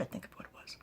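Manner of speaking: soft breathy whisper; whispered aside. Real, not acted. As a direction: keep it a hushed secret.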